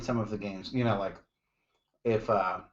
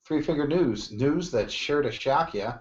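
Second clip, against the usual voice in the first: mock announcer; 5 semitones higher, 3 dB louder than usual. Announces segment name.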